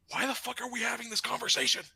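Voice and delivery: fast, high incredulous question; exasperated disbelief. Retold or imitated. Applicant questions the armed manager's fear, exasperated. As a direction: fire it off high, baffled and exasperated.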